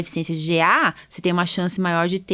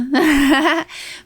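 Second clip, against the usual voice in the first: short laugh; 7 semitones higher than usual. Real, not acted. Laughs while introducing guest.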